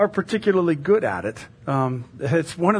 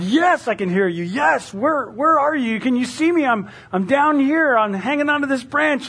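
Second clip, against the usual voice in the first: high, eager calling voice; acted yell; 9 semitones higher than usual. Hiker on the branch to the voice above, acted yelling. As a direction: yell up to an unseen rescuer, storyteller style. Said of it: "And he yelled".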